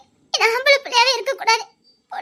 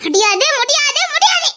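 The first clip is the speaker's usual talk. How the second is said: a rising, louder "முடியாது" three times over; shouting refusal; 4 semitones higher, 5 dB louder than usual. Acted. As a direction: shout him down, each refusal higher. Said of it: "ரொம்ப தான் கத்தி கத்தி பேசிக்கிட்டு இருக்க".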